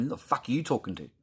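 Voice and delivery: retold challenge. Retelling his comeback to the bossy doctor, confrontational. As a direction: square up to him in the retelling.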